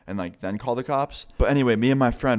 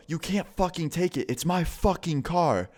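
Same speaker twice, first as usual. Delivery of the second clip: high-pitched mock yell, slow at the end; imitating the friend yelling at his ex; 8 semitones higher than usual. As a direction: replay the friend's angry yell without full volume. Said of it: "I hear my friend yell out".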